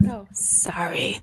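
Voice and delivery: a quick whispered apology; whispered sorry. Real, not acted. Told off about the mic, she whispers sorry. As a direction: whisper it, caught off guard. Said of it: "bring your microphone to your mouth".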